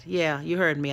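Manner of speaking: ordinary talk, nothing marked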